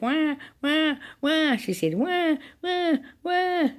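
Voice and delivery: put-on high baby wah cries; baby bawling. A narrator's reading. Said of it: "bawling her head off".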